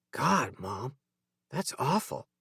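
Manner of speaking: a hint of a sympathetic teen son; son's voice. A narrator's reading. Son to mom after her story, softly voiced.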